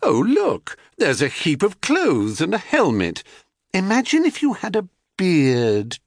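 high, feigned surprise; airy goddess making a sly suggestion; scheming goddess. Acted. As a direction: pretend to just notice it, sing-song and high. Selene feigns a discovery.

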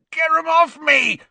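very high, loud, frantic yell. A narrator's reading. Ron yells as birds peck and claw at him. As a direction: yell for help, pitched right up. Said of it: "he yelled".

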